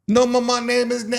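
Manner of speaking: high mimicked protest; imitating resort man. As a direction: insist in the man's high voice.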